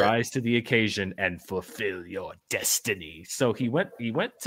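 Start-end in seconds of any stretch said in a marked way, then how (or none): 0.0-3.2 ominous, theatrical villain delivery; evil emperor. Acted. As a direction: end it like a movie emperor pronouncing fate. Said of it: "he went all emperor there".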